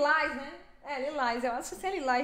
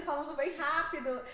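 usual speaking voice

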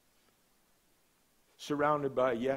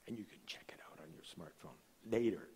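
quick hushed aside, faster; whispered aside; 7 semitones lower, 9 dB quieter, faster than usual. Real, not acted. Pastor joking aside to the congregation, quick whisper. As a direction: toss it off in a whisper.

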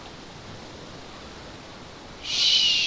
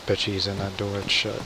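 ordinary talk

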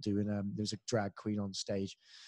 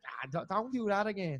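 imitating wincing Irish fans; 7 semitones higher than usual. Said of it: "they're like".

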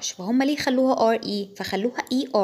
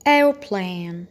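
American accent; 4 semitones lower than usual. Shown as a demonstration. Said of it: "American و British".

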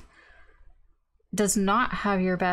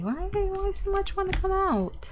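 much higher, teasing voice to the card; high playful scolding; 12 semitones higher than usual. Pretend. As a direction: chide the card like a cheeky pet. Scolding a card that keeps jumping.

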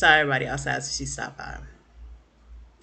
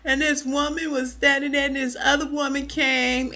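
mock crying recount; 8 semitones higher than usual. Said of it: "she's bawling her eyes out".

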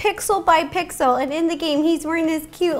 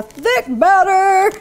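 loud, higher burst of effort; playful straining; 4 semitones higher, 6 dB louder, much slower than usual. Real, not acted. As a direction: push the word out while stirring hard. Effortful stirring of thick batter.

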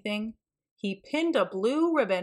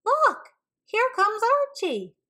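much higher and louder shout; 13 semitones higher, 5 dB louder than usual. A narrator's reading. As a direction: shout it across the crowd. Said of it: "someone shouted".

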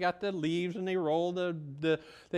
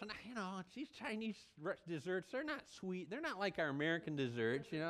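hushed aside; 12 dB quieter, faster than usual. Real, not acted. Aside about Chinese desserts.

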